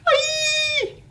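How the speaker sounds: very high piercing shriek; the singer shrieking at the mouse; singer's shriek. A narrator's reading. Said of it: "she screamed and screamed".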